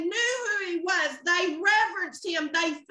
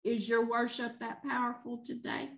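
quiet challenge; 8 semitones lower, 9 dB quieter than usual. Real, not acted. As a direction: drop to a soft, hushed question.

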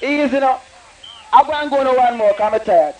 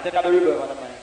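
softer, lower, drenched in echo; reverb on the mic; 7 semitones lower, 4 dB quieter, much faster than usual. Shown as a demonstration. Deejay tests the reverb on the mic, echoing and softer. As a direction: say it again with the reverb washing over it.

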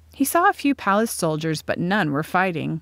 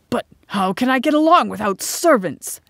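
higher, indignant question; demanding; 5 semitones higher, 4 dB louder than usual. A narrator's reading. Emain is told servants may stay, demanding indignantly. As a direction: demand it in affronted protest. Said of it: "demanded Emain".

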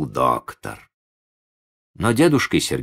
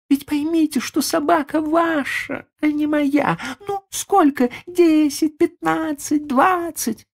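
high, impatient lady bargaining; a rich lady pushing a price; haughty lady. Acted. The lady haggling for the dog.

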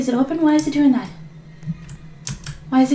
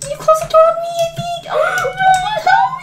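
high panicked shrieks for help; panicked screaming; 3 dB louder, much faster than usual. Real, not acted. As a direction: scream it, trapped and terrified. Mom trapped by monster, screaming.

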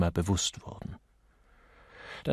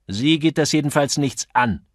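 raised, curt rebuff; grumpy; 6 semitones higher, 7 dB louder than usual. A narrator's reading. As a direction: brush off the busybody crossly. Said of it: "versetzte Mister Talboy mürrisch".

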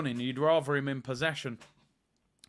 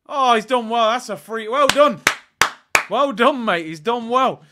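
loud, higher bursts of praise; excited praise; 6 semitones higher, 10 dB louder than usual. Real, not acted. Fan streamer cheering Havertz for winning a free kick, loud and excited. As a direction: cheer the player on.

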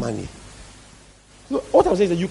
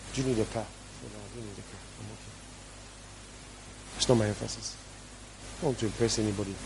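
quiet aside; 6 semitones lower, 14 dB quieter, faster than usual. Real, not acted. Dismissive low aside about car offer.

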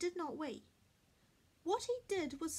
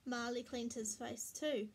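usual speaking voice

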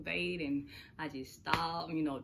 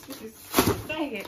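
mock despair; 6 semitones higher, 8 dB louder, much slower than usual. Real, not acted. Repeated, now louder and drawn out.

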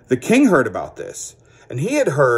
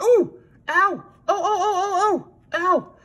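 very high, slow puppet whimpering; princess puppet crying ow. Acted. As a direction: squeal in pain as a princess puppet. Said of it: "Sally sits down, and she's like".

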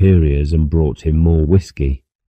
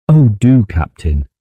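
sudden high, louder plea; eager exclamation; 6 semitones higher, 4 dB louder than usual. A narrator's reading. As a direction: grab at the captain's offer. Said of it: "she exclaimed".

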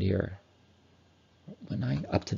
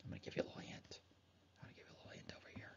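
playful whisper; 26 dB quieter, much faster than usual. Pretend. Teacher whispers a secret hint.

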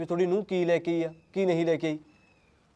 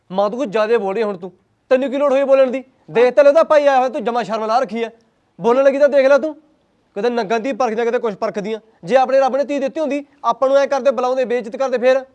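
loud, higher, furious scolding; angry outburst; 4 semitones higher, 9 dB louder than usual. Acted. Son shouting at his mother in front of her brother, loud and furious. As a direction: yell at her to stop talking.

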